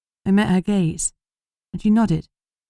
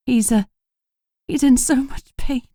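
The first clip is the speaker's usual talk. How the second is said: strained, forced out; 7 semitones higher than usual. A narrator's reading. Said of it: "forced out the words".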